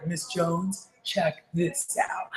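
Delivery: hushed, drawn-out sales tease; fired-up imitation. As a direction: imitate a junkie salesman, very soft and slow. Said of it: "they're, like, so fired up".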